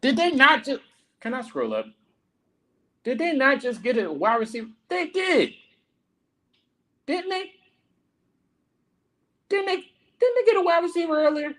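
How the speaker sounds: very high, stretched disbelief; high confused fan checking the picks; incredulous squeak. Real, not acted. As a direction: squeak it out like you can't believe it.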